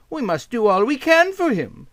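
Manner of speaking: put-on aunt resolves to help the boy, high voice; aunt deciding. A narrator's reading. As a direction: settle it with resolve. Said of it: "she decided".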